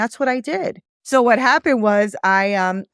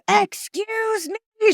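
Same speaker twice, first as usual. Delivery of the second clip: high-pitched, drawn-out mock-outraged "excuse me"; hammed-up indignation; indignant outrage; 14 semitones higher, much slower than usual. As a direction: imitate an offended client, stretched and shooting up high. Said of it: "She was so pissed off".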